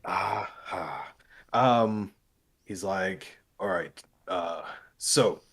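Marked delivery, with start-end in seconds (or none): 3.6-5.4 lightly voiced douche; casual, lower douche drawl to open business. Guild man starts his briefing, lightly voiced douche. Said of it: "You know a douche when you hear that voice".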